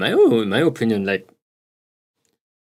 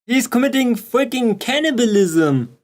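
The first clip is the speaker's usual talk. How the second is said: excited shouting; 10 semitones higher than usual. Real, not acted. Freaking out over the chair video.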